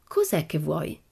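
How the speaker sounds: mildly perplexed shopkeeper; rather puzzled. A narrator's reading. Shopkeeper to an odd child customer, mildly puzzled. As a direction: ask with a touch of bewilderment. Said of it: "chiese la signora piuttosto perplessa".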